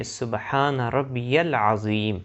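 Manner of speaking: slow, full سبحان ربی العظیم; slow correct recitation. Shown as a demonstration. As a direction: recite سبحان ربی العظیم slowly, every letter clear. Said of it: "صحیح جو ادا کی جاتی ہے وہ اس طرح ہے". (سبحان ربی العظیم صحیح تلفظ، آہستہ)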